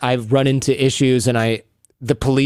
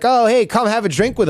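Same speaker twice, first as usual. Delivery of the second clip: high, chummy welcome; imitating friendly locals, pitched up; eager invitation; 9 semitones higher than usual. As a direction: wave strangers over with a raised voice. Acts locals inviting him over.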